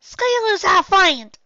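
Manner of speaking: high, loud, slow defiant shout; filly yelling. Acted. Little filly yelling at her big sister, high and loud. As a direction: yell it out like a stubborn little filly. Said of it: "the filly yelled".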